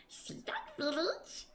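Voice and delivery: slow, breathy, high creature whisper; whispering sprite. Acted. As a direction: lean in and whisper like a tiny schemer.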